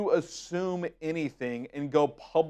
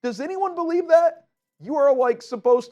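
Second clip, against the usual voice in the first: much higher and louder, disbelieving; incredulous scoff; 11 semitones higher, 6 dB louder than usual. Real, not acted. Host mocking the recant to viewers, incredulous. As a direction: challenge the audience in disbelief.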